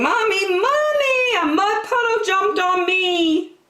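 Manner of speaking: put-on high, upset little-girl cry for mommy; a muddy little girl wailing for her mother; child yelling for mommy. A narrator's reading. Muddy little girl calls her mommy, high and upset. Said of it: "ran inside yelling".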